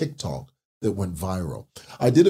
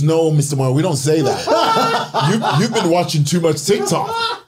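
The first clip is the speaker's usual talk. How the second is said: South African commenter; 8 semitones higher, 7 dB louder than usual. Retold or imitated. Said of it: "the reaction says".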